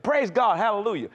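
loud, high mock praise; imitating a reflex church reply; automatic religious response. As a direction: fire off a pat hallelujah without listening. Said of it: "I responded religiously".

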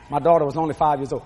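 usual speaking voice

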